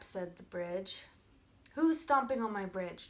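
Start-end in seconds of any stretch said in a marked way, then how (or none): 1.7-3.1 mildly yelled troll challenge, louder; troll's yell. Acted. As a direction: call out like an annoyed troll. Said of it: "yelled the troll".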